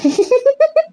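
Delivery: high burst of laughter; laughing. Real, not acted. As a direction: break into a sudden high laugh. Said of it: "ஏன் அப்படி சிரிக்கிற?".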